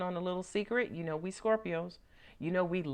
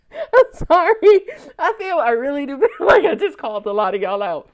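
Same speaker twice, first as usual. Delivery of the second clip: laughing apology; 6 semitones higher, 13 dB louder than usual. Real, not acted. Apologizing to Scorpios for calling them out, laughing loudly. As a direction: laugh through the apology, loud.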